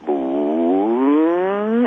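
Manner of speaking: saucer powering up, pitch climbing slowly; imitated rising hum. Witness shows how the craft sounded, imitated rising whine. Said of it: "as it became audible and raised in pitch".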